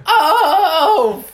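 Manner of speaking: long, high yodelled wail, very loud; yodel-like yell. Retold or imitated. Imitating the stranger in the bathroom stall, loud yodel-like yell. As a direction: yodel it out, then drop onto the curse. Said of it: "came out like a yodel".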